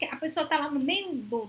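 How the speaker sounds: ordinary talk, nothing marked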